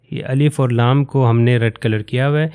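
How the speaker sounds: ordinary talk, nothing marked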